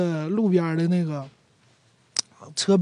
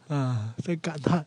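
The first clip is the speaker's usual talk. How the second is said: ordinary talk, nothing marked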